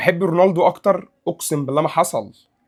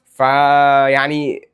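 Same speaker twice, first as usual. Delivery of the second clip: long drawn-out so; 5 semitones lower, much slower than usual. Real, not acted. Drawn out filler.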